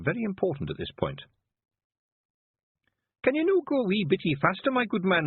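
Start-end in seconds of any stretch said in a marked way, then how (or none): 3.2-5.3 high, loud Scots voice pressing for speed; MY stressed, high and loud, in a Feegle voice; high impatient Scots wee man. Acted. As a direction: hurry the carter along in a high Scots voice. Feegle urging carter faster.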